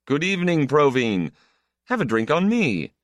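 high, hearty greeting; drunk man's greeting. A narrator's reading. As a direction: hail a drinking companion. Drunk man hails Provine in bar.

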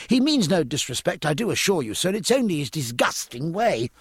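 high, anxious reassurance; mildly anxious apology. A narrator's reading. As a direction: hasten to excuse a friend, a touch worried. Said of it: "cried Babington anxiously".

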